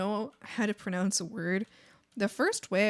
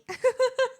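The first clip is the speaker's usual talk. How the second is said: high squeal of laughter; 4 dB louder than usual. Real, not acted. Laughing at herself in front of chat, high squeal. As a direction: burst of high giggling laughter.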